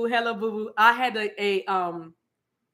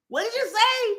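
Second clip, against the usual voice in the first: much higher put-on voice, sharp question; skit character; 11 semitones higher than usual. In the Santa skit, a high challenging question. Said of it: "I did a whole Santa Claus, Missus Claus".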